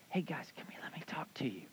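a hushed, secretive call to come closer; whisper. Retold or imitated. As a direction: whisper it like God calling them aside. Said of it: "so he goes like".

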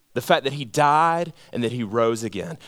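normal talking voice

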